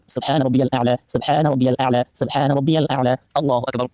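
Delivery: low, steady recited prayer formulas; murmured prayer. Acted.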